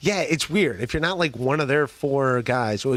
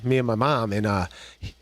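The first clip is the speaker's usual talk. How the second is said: normal talking voice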